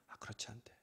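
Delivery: soft aside. Retold or imitated. Relaying a reply softly.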